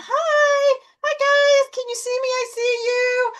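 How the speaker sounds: greeting the orb in a silly high voice. Retold or imitated. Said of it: "and I'm waving".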